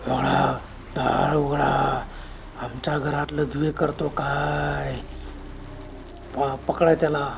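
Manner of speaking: low, slow ghostly call at the door. Acted. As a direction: drag the words out like a ghost. (खोल, संथ भुताचा आवाज)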